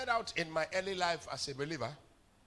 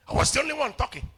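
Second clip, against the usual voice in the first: much higher and louder, preaching it home; emphatic loud repeat; 10 semitones higher, 9 dB louder, faster than usual. Acted. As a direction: hammer the line at the congregation.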